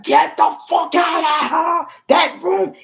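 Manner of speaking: high, loud yelled order to get out; furious mom shout. Retold or imitated. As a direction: scream like an enraged mother throwing him out. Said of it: "she was like".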